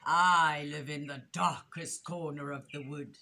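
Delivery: low, ominous weasel voice; sinister storybook villain; menacing hiss. A narrator's reading. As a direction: lean on the first word with dark relish. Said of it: "she hissed".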